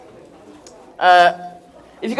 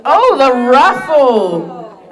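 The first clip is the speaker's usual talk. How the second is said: sudden realisation; 4 semitones higher, 7 dB louder, much slower than usual. Real, not acted. Host suddenly remembers raffle.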